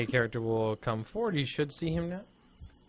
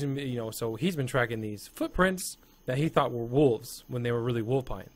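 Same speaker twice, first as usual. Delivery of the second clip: ordinary talk, nothing marked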